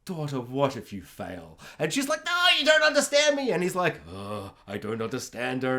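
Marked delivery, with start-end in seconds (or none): 0.0-1.6 lower, much softer fatherly voice; a hint of a doubtful old-fashioned father; worried dad. Dad questioning his daughter, low and soft. 2.2-3.5 put-on much higher, fast whiny girl's protest; upset daughter. Daughter protesting to her dad, high and fast. 4.1-5.8 lower, much softer grumble; baffled dad. Retold or imitated. Dad complaining about his daughter, low and soft. Dad's puzzled reply.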